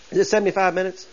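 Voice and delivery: normal talking voice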